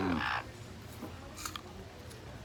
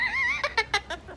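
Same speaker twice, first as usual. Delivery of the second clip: shrill delighted laugh; high squeal of laughter. Real, not acted. Laughs at pet.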